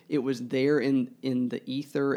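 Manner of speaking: ordinary talk, nothing marked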